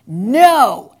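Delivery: shouted no with a jump in loudness; border patrol screaming. Acted. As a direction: bark the orders like a screaming guard. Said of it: "the Border Patrol starts screaming at me".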